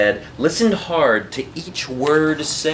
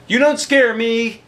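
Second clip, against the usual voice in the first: put-on higher, defiant girl's voice; brave child; 9 semitones higher, 4 dB louder than usual. A narrator's reading. Eliza to the shadow, defiant and higher. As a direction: bravely defy the dark shape. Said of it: "Eliza thought".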